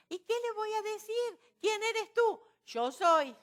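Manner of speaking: loud, high-pitched dramatized question and answer; impassioned exchange. Acted. Preacher acting out Moses asking God who he is, impassioned. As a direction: proclaim it high and forcefully.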